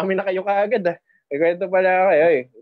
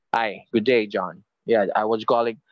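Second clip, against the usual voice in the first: deep phone voice; 9 semitones lower than usual. Shown as a demonstration. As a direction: drop the voice low like a radio DJ. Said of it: "binababaan ko pa yung boses ko".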